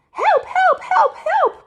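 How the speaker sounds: very high, loud repeated cries; the girls shriek for help as they fall; panicked cries for help. A narrator's reading. Four sisters shrieking for help, very high. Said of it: "cried Lolly, Molly, Polly".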